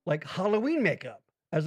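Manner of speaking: normal talking voice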